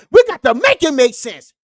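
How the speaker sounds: "We got to make it make sense" diye bağırarak vaaz eder gibi; preachy shout. Acted. Catchphrase shouted to viewers.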